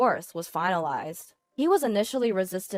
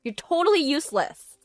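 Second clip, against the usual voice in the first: higher-pitched angry demands; scolding mistress; 5 semitones higher, 5 dB louder, faster than usual. A narrator's reading. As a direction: tear into him for being useless.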